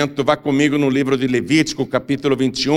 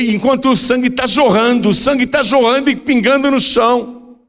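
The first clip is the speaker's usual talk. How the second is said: urgent, high-pitched preaching cry; impassioned preaching; 12 semitones higher, 4 dB louder than usual. A narrator's reading. Preacher telling of blood gushing to the floor, impassioned. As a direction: build alarm with a raised, pressing voice. Preacher dramatizing a bleeding wound.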